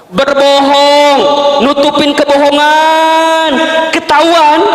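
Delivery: slow, high drawn-out emphasis. Acted. Preacher stretching the words out slowly for the mothers. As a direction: stretch each word out slowly.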